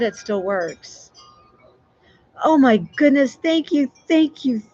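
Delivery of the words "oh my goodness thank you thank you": high, breaking into rapid-fire thanks; stunned, pour out thanks on a raised pitch; overwhelmed gratitude. Real, not acted. Streamer stunned by a viewer's generous gift, overwhelmed. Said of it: "I'm speechless".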